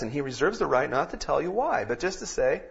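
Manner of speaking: ordinary delivery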